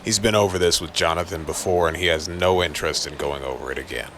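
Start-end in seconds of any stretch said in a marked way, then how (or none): none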